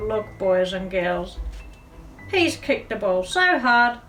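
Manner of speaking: plain reading voice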